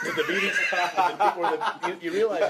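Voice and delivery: laughing while asking; giggling question. Real, not acted.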